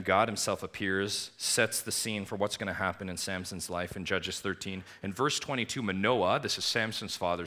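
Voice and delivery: usual speaking voice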